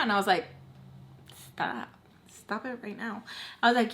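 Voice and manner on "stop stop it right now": very soft, half-whispered telling-off; hushed protest. Retold or imitated. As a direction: act out a flustered warning under your breath. Flustered reply to his flirting.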